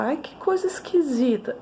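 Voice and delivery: mock a dismissive adult; scornful remark. Teacher mimicking what never to say to a child. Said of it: "Jamais você fala".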